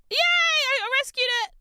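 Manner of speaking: high, loud cheer; real delighted cheer; triumphant yay. Real, not acted. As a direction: cheer at rescuing the piece. Finds piece.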